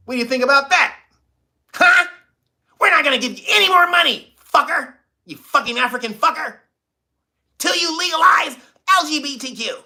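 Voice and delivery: high, loud mocking taunt; mock America taunting. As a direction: jeer at Uganda as a bully would. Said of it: "So America's like".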